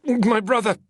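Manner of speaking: frightened. Acted. Frightened senator pleads.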